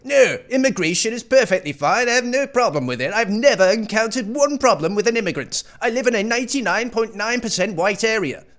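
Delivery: countryside boomer. Retold or imitated. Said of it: "these boomers in the countryside that are like".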